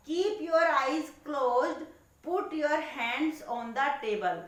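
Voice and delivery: slow, careful instruction reading. Shown as a demonstration. Teacher slowly reading part D instructions to children. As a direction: spell out each command slowly. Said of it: "teacher reads next instructions".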